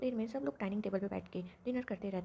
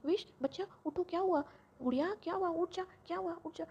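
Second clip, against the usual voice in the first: high, anxious calls to wake her; worried waking call; 6 semitones higher than usual. Acted. Mother waking her screaming daughter, high anxious calls. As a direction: shake her awake with rising alarm.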